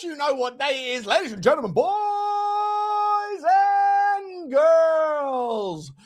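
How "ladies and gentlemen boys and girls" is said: ringmaster announcer. Acted.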